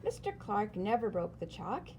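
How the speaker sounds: usual speaking voice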